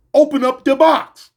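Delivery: loud, slow, barked order; menacing command. Retold or imitated. Narrator retelling his threat to the dude, loud slow command. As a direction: hammer each word as a threat.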